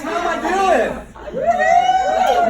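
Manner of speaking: high, loud, slow laughing brag; gloating laugh. Real, not acted. Gloating after winning streak.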